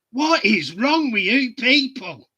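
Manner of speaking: mock outraged cartoon dog; exasperated cartoon quote. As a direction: throw up your hands at these people. And went.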